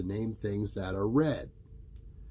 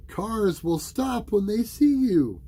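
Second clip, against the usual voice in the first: higher, louder motherly voice; mother's voice; 12 semitones higher, 7 dB louder than usual. A narrator's reading. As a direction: reassure the child firmly. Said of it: "his mother told him".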